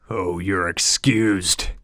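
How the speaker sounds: slow sneering "Oh, you're excused". A narrator's reading. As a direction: dismiss him with contempt. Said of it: "I sneer".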